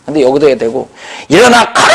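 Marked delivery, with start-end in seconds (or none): 1.3-1.9 raised, abrupt order; shouted command. Retold or imitated. Preacher acting Paul at a congregant, shouting the order. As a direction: throw the command out high.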